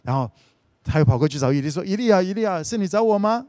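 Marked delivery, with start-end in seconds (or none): 1.8-3.4 put-on higher child's calling question; a boy calling out to his master; eager boy. Boy Samuel calls Eli again, higher and eager.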